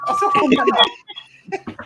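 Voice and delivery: very high, slow, stretched words; high drawn-out whine. Pretend. Drawn-out repeat of the line.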